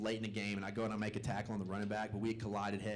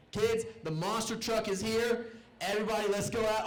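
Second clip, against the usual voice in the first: higher-pitched excited mom, retold; excited mom calling; 8 semitones higher than usual. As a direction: imitate a mom announcing a big surprise. Mom calls kids outside, retold.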